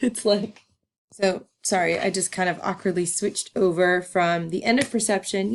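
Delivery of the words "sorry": ordinary talk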